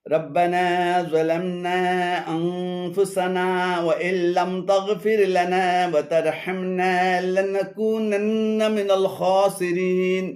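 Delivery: slow, low, measured recitation; Quran recitation. Acted. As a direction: recite the dua slowly and solemnly. Recites dua. (ধীর কুরআন তিলাওয়াত)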